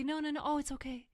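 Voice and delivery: fussy soothing, easing off at the end; uneasy reassurance. Retold or imitated. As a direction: mimic a quick, nervous brush-off. Acting out the reassurer once more.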